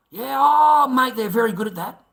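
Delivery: sarcastic praise. Real, not acted. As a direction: heap on sarcastic praise, pitch leaping up. Sarcastically praising his opponents.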